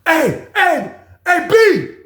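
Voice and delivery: high shout down the tier; shouting to the next cell. Retold or imitated. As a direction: call out to a neighbor's cell. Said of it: "He was like".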